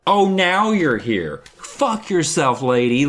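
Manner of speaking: high, slow mock outrage; sarcastic, fed-up protest; indignant. Acting out indignation at the woman.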